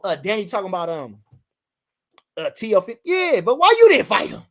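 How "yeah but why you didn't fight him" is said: high, loud demand; loud challenge. Real, not acted. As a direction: call him out hard. Challenging Danny loudly.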